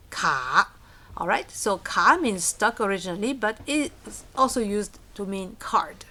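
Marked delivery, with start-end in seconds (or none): 0.1-0.7 "ka" dipping low, then rising; low dipping tone on "ka", lifting at the end; third tone. Shown as a demonstration. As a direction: draw "ka" down and back up. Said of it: "Third tone, down, up".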